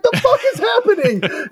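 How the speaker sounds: high, slowed mock bewilderment; comic confused squeal; imitated bafflement. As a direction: draw it out high as a baffled friend. Buddy's retold bafflement.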